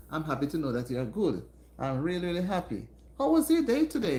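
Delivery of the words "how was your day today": high, loud and eager question; excited host asking the room; bright excited greeting. Real, not acted. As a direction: lift it up to greet the chat warmly. Cheerful greeting to live viewers.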